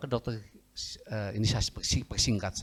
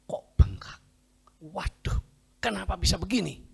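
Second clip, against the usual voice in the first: mildly startled, higher-pitched question; alarmed doctor; 8 semitones higher than usual. Retold or imitated. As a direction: voice a puzzled doctor's dismay. Acted doctor sees the swelling.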